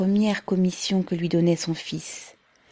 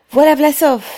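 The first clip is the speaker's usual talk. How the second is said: much higher, louder crowd cry; shouting out; 9 semitones higher, 10 dB louder than usual. A narrator's reading. A worker spotting Pavel in the crowd, shouting out. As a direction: hail him across the yard. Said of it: "s'écria quelqu'un".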